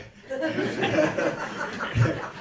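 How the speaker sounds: high, loud helpless laugh; cracking up at the memory; burst of laughter. Real, not acted.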